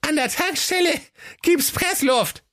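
very high goat voice, gasping; panting goat. A narrator's reading. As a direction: call it out breathlessly like Cindy. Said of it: "japste das Geißlein Cindy". (hohe Geißleinstimme, japsend)

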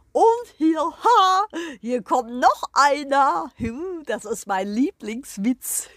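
crowing rooster voice, loud and pompous; proud rooster. Acted. The rooster greets the hens and the guest, proud crowing voice. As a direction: strut and crow like a proud rooster.

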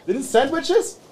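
high hopeful call. Acted. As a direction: call out sweetly to the guard girl. Calls out to the girl. (hoch rufend)

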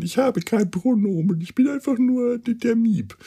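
high squeaky little alien voice; cute creature voice. Acted. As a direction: chirp it like a tiny fluffy creature. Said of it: "Und der Miep sagt dann".

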